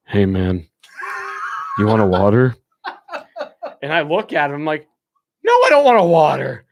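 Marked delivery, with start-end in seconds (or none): 0.1-2.6 lowered, half-awake drummer voice; groggy drummer. Retold or imitated. Drummer wakes briefly and offers a water, groggy and lowered. 5.4-6.6 higher, louder incredulous no; exasperated retort. Retold or imitated. Narrator answers his drummer's absurd offer, loud and exasperated.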